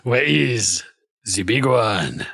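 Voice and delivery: slow, drawn-out mock French; mimicked French accent. Game master echoing the knight, mock French accent. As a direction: imitate the French knight slowly.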